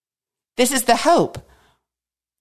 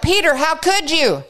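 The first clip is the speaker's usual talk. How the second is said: high, incredulous how could you; mock reproach; 11 semitones higher, 3 dB louder than usual. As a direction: scold Peter in disbelief. Mock scolding of Peter.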